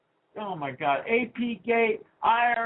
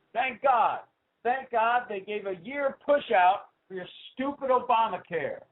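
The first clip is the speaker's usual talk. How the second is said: ordinary delivery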